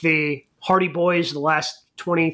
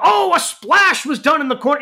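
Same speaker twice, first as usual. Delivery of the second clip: higher, excited commentator call; wrestling announcer; 7 semitones higher, 4 dB louder than usual. Retold or imitated. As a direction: call it like a commentator covering a botch. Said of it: "the announce crew for A E W".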